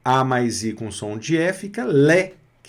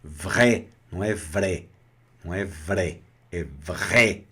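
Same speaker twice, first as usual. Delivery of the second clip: vrai spat out with hammed-up spite; low, grumpy mock vrai; mock angry vrai; 5 semitones lower than usual. Shown as a demonstration. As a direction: fake fury on vrai. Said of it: "na força do ódio".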